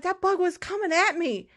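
high-pitched mock panic; panicked self-defence. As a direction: plead your case in acted alarm. Defends panic over the bug.

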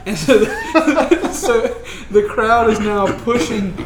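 talking through real laughter; laughing. Real, not acted. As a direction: chuckle while narrating the riot. GM laughs at the chaos.

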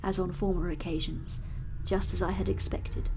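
steady, measured reading of a classic novel; story narration. A narrator's reading. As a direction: read it like an audiobook narrator. Narration resumes.

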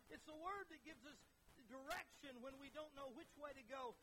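rhythmic, emphatic pulpit refrain; fervent preaching. Real, not acted. Preacher praising what the word gives us, fervently. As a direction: drive home each repeated phrase.

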